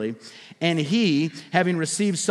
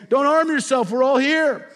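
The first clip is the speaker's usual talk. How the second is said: high, urgent call across the prison; Paul calling out to the jailer, loud and high; loud cry; 7 semitones higher, 3 dB louder than usual. Acted. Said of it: "Paul cried out in a loud voice".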